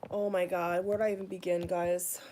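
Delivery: ordinary delivery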